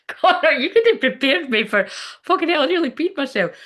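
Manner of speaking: high, loud, bursting with laughter; can barely talk for laughing. Real, not acted. Cracking up at the card.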